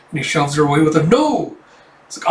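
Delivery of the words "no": put-on high, loud angry kid yell; a boy pushing her off; shrieking no like a small boy; child's shout. Boy shoves woman away.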